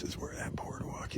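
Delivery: soft breathy whisper. Real, not acted. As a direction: hush it like you're in nature. Hushed on a quiet nature walk.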